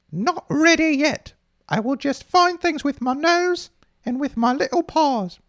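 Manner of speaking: very high, small newborn kitten voice; a blind baby kitten feeling its way, squeaky and high. Pretend. Host voices a newborn kitten with closed eyes, very high.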